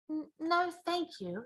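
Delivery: put-on soft, hesitant little girl; nervous refusal. Sophie refusing the giant's vegetable, nervously. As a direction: stammer it, backing off.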